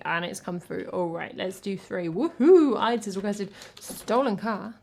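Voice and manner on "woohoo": high, loud whoop; loud, high cheer. Real, not acted. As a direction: whoop it out in celebration. Cheering a song request.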